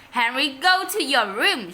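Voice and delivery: loud, scolding mother; angry shout. A narrator's reading. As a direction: shout the order at him. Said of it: "shouted Mom".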